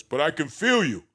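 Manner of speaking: loud, emphatic imitation of a seminar coach; Tony Robbins. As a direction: imitate a motivational speaker projecting. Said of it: "with his big Tony Robbins voice".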